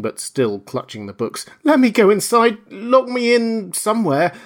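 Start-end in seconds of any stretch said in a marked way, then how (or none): 1.6-4.3 high, loud frightened pleading; begging for shelter in terror; weeping panic. Acted. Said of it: "staggering and weeping".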